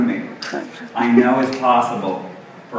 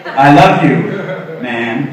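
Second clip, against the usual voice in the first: much louder, hearty I love you; macho bro version; 4 dB louder than usual. Retold or imitated. Guy tells another guy he loves him, loud and hearty bro style. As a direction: belt it out like a bro. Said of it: "they usually have to put a man after it".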